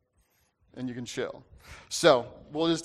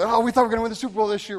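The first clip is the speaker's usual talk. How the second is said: fast, raised, louder mock fan panic; freaking out; 7 semitones higher, 7 dB louder, much faster than usual. Packers fans who expected a Super Bowl, mock freak-out. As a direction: imitate fans freaking out, high and hurried. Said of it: "everyone's freaking out".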